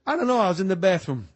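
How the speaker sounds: loud, high mock-innocent parroting; mocking payback. Coach throwing the alibi back, mocking.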